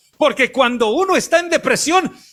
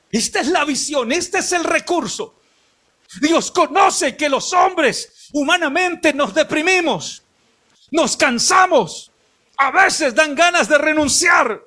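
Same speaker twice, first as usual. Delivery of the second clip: más aguda, lenta y con gritos de púlpito; predicación enérgica; 5 semitones higher than usual. Acted. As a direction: predica con fuerza a la congregación. (pastor exhorta sobre la depresión, predicando a gritos)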